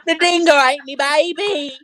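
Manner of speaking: high-pitched mock Aussie wail; mock Australian. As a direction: join in hamming up an Australian accent. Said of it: "bad fake Australian imitations".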